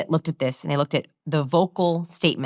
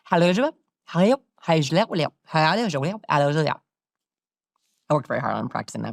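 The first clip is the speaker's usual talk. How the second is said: warbled speech; much faster than usual. Shown as a demonstration. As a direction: imitate words warbled beyond recognition. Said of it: "So it sounded like this".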